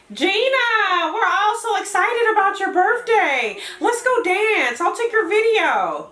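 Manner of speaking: high, bubbly excited friend; Joy. Acted. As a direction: gush like a party-hungry friend. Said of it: "let's do the voices".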